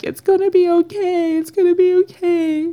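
very high, mock-crying voice; imitating tearful reassurance. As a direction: imitate a sister crying while she comforts you. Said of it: "she starts crying on the phone".

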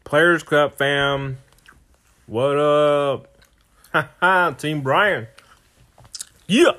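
loud, high hype shout-out; excited greeting. Real, not acted. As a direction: pump up the fans. Hyped greeting to listeners.

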